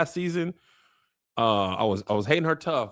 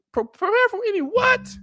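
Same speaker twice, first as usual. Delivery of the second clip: much higher, incredulous repeat of what; outraged disbelief. Real, not acted. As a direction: throw the order back in disbelief. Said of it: "First of all, y'all haven't delivered".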